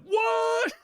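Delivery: shrieked high, loud what; outraged what. Retold or imitated. Predator fans shrieking in outraged surprise. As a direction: fans crying out in disbelief.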